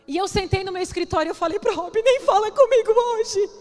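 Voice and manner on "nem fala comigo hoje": high, loud and slow, voice near tears; choked-up outburst. Retold or imitated. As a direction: blurt it out hurt and ashamed, holding back tears. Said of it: "Desse jeito". (desabafo alto e lento, quase chorando)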